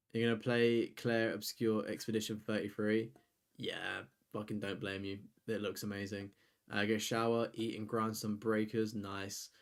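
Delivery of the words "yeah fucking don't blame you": usual speaking voice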